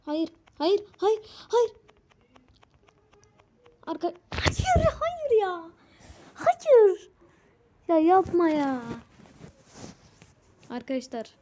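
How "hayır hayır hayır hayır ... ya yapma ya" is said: high, loud panicked wails of no; real shrieking despair at the game; desperate screaming. Real, not acted. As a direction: scream no as you lose. Losing to the boss.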